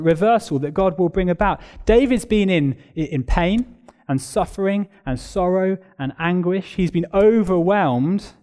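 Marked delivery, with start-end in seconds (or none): none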